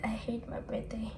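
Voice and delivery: whispered, unvoiced and soft; a hushed repeat of the complaint. Real, not acted. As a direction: breathe it out as a whisper.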